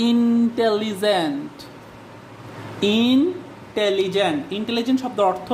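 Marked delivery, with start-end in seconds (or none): 0.1-1.6 intelligent stretched slowly, syllable by syllable; drawn-out word. Shown as a demonstration. Teacher sounding out a long word for students, intelligent drawn out. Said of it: "আমি যদি বলি". 3.0-4.4 intelligent slowly split, pause after the first syllable; broken into syllables. Shown as a demonstration. Teacher showing students the word's breaks, intelligent slowly split up. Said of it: "আমি যদি বলি".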